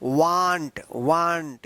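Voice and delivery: वांट said slowly as "waant"; wrong "aa" vowel. Shown as a demonstration. Teacher repeating the wrong pronunciation for learners, with aa. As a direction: open वांट wide to an "aa". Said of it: "ये गलत उच्चारण है".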